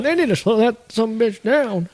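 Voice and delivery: high, drawn-out mock grumble; hammed-up onlooker complaining; Tucker impression. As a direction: put on a slow, high voice of complaint. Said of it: "Tucker's watching, going".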